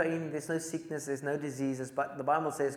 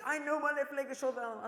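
put-on grumpy old man; 11 semitones higher, faster than usual. Angry old man rebukes preacher.

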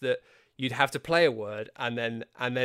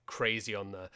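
ordinary talk, nothing marked

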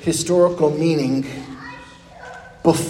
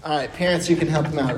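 ordinary talk

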